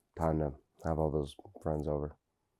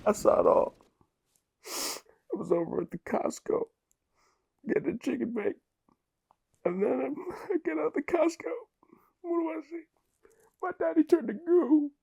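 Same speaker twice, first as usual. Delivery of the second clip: very high, squeaky sobbing voice; hammed-up wailing. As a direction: bawl through the story, pitch shooting way up. Wails over father's death in crash.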